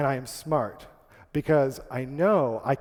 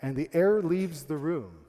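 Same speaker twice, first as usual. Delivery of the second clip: ordinary delivery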